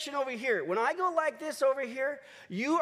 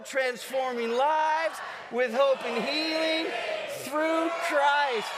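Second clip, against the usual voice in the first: slow, shouted stadium chant; loud cheer; 3 semitones higher, slower than usual. Real, not acted. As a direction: lead the final chant, drawing out each phrase. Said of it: "Even louder".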